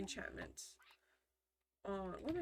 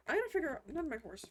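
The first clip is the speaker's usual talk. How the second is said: ordinary talk, nothing marked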